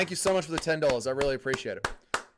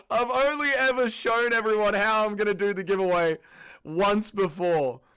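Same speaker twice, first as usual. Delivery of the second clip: ordinary talk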